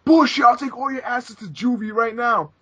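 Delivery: high mock threat from a cop; retold cop threatening juvie. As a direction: threaten them like a hothead cop. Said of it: "And he's like".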